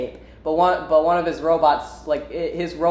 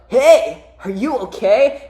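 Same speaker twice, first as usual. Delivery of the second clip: raised, loud cartoon-hero check-in; higher-pitched mock concern; Sonic; 6 semitones higher, 5 dB louder, slower than usual. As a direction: call over in a perky put-on voice. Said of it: "Sonic kicks his ass".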